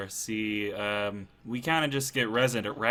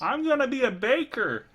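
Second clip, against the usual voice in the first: high cheerful skeleton; 12 semitones higher, 4 dB louder than usual. Acted. One freed skeleton announcing plans, high bright voice. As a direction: chirp it out, much higher. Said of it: "says one".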